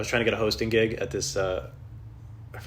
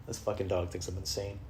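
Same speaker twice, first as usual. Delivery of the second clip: ordinary delivery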